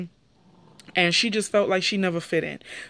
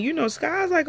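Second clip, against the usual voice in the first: ordinary delivery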